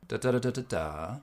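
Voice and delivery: sing-say the tune of a question on "da"; sentence melody on "da". Shown as a demonstration. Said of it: "I'll have them repeat back".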